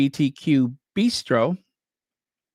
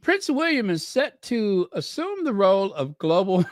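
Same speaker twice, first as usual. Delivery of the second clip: breaking into laughter; 7 semitones higher than usual. Real, not acted. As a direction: read it higher, then crack up helplessly. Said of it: "can never say that without laughing".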